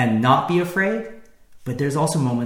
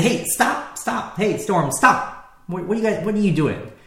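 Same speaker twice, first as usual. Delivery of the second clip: much higher, sharp commanding calls; commanding rebuke; 6 semitones higher than usual. Acted. Jesus rebuking the wind and waves: high, commanding shout. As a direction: order the storm to stop.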